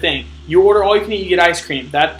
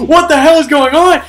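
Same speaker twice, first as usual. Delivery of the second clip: higher and louder outburst of disbelief; mock disbelief; 10 semitones higher, 7 dB louder than usual. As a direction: burst out in comic shock. Reacting to huge food pile.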